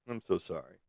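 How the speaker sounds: normal talking voice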